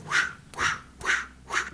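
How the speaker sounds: airy whooshes for swishing pants; voiceless repeated whoosh; imitated pants swish. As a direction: make rhythmic fabric-swish noises.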